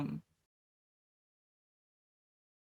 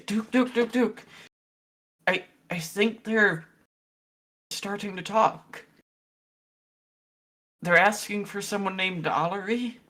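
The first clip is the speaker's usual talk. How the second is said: urgent calling out; 5 semitones higher, 4 dB louder than usual. Acted. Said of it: "and just say".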